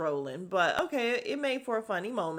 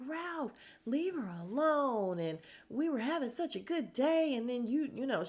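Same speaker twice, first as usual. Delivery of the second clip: imitating crying sister; 5 semitones higher than usual. Scene: sister begging Ralph to leave Drew alone, mock tearful plea. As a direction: plead with him like she's crying. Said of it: "she's crying".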